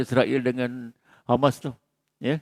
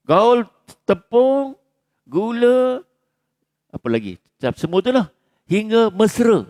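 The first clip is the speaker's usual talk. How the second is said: emphatic call; 8 semitones higher, 3 dB louder, slower than usual. Acted. Preacher emphatic about recipe.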